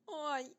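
high, loud "ouch" cry; yelp of pain. A narrator's reading. Rasmus kicks a stone, yelping in pain. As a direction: yelp sharply at a stubbed toe.

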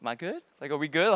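low, uneasy check-in; mildly nervous asking for reassurance; acted touch of unease. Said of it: "I'm getting a little bit nervous".

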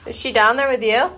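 loud call down the stairs. Real, not acted. Owner calling downstairs to ask about the puppy. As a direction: call it down to the basement.

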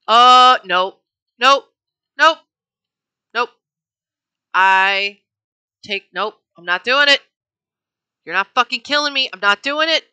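loud, panicky mock refusal; frantic refusal. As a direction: yell no over and over, refusing to go. Mock murderer refusing police, panicked.

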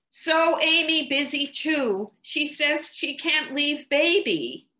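put-on high, loud small-child voice, pleading through the door; a small child begging mama to help at once; little child calling. Small child calling to mama through the locked door, high and loud.

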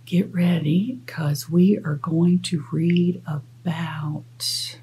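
slow hushed whisper; slow secretive whisper. Acted. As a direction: draw out the suspense in a whisper. Building suspense before the reveal.

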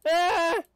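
short loud "ah!"; sudden yelp at a zombie; quick burst of alarm; startled yell. Real, not acted.

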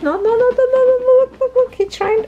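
urgent and thrilled, way up high; excited high no. Real, not acted. Owner exclaiming as a puppy tries to climb out, excited.

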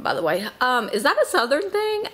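normal talking voice

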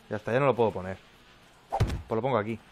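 ordinary talk

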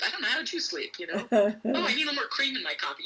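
high, breezy pretend small talk; fake casual chatter. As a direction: act innocent, nothing to see here. Faking innocent small talk.

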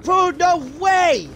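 slow, high drawn-out disbelief; stunned disbelief. Real, not acted. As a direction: stretch it out, amazed. Recognizes killer as friend.

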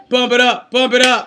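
chanted hype-man backing; punchy rhythmic ad-lib. Shown as a demonstration. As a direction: chant it behind a singer. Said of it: "I'm gonna be the hype man".

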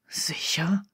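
leise, tonlos geflüsterte Frage; geflüstert. A narrator's reading. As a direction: ganz leise hauchen. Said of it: "flüsterte Draco". (Draco vergewissert sich bei Harry, tonlos geflüstert)

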